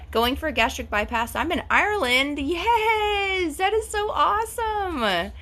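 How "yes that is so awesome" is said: high, slow delighted gush; excited. Real, not acted. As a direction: squeal it out with delight. Viewer is writing from Ireland.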